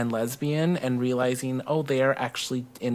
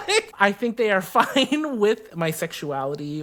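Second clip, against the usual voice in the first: laughing; 7 semitones higher, 3 dB louder than usual. Real, not acted.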